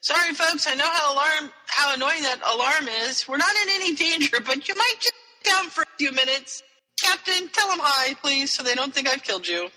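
lightly acted, high-pitched announcement; loudspeaker announcer. Pilot reassuring passengers over the loudspeaker, lightly acted announcement. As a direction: imitate an apologetic pilot addressing everyone aboard. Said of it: "he gets on the loudspeaker".

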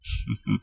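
short soft chuckle; brief, quiet little laugh; warm chuckle. A narrator's reading. Wizard amused by a girl's brothers, warm chuckle. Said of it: "Godric chuckled warmly".

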